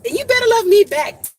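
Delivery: high, loud and quick playful demand; playful exclaim. Real, not acted. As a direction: tease the viewers warmly. Playful sign-off to viewers.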